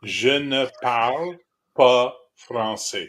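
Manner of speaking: Je ne parle pas français, slow, leaning on pas; French phrase repeated slowly. Shown as a demonstration. Correcting a friend's French, slow with stress on pas. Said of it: "repeat after me".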